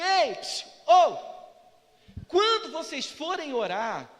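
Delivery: loud attention-grabbing hail; calling out. Acted. Jesus getting disciples' attention before teaching prayer, calling out. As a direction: call them over, then slow down.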